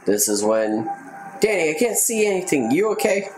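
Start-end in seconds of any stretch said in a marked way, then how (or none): 1.4-3.3 put-on higher, fast panicky kid voice; mock panic. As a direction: play it like a lost scared kid. Voicing Hop panicking in fog.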